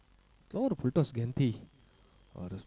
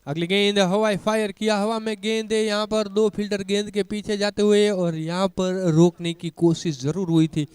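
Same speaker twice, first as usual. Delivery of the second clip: rising, louder play-by-play as the ball flies; excited cricket commentary; 7 semitones higher, 5 dB louder than usual. Real, not acted. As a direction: call the big shot with a rush. Six hit, ball in the air.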